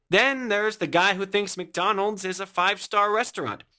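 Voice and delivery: normal talking voice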